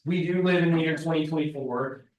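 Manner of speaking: ordinary talk, nothing marked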